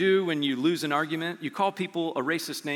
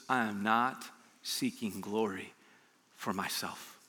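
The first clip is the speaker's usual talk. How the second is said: low, slow and soft, reverent; hushed Jesus quote; 8 semitones lower, 10 dB quieter, slower than usual. Acted. Preacher voicing Jesus to the congregation, hushed and slow. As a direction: let the words sink, quietly.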